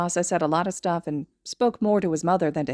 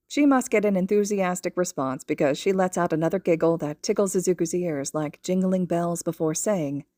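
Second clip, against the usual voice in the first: ordinary delivery, nothing marked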